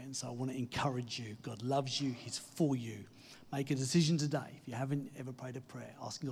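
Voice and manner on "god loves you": ordinary delivery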